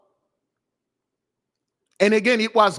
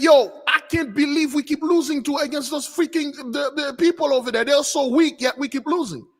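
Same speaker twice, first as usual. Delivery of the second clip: higher-pitched retold gripe; complaining; 6 semitones higher than usual. Zippo complaining to his side about losing, exasperated. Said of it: "Zippo was there complaining".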